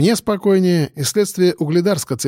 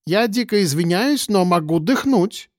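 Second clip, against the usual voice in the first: polite intellectual; 5 semitones higher than usual. Acted. Intellectual pleads.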